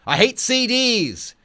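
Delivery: high, loud and slow, a hammed-up cowboy cry; mock proclamation. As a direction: belt it out like a crazed song title. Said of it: "proclaiming in one song title".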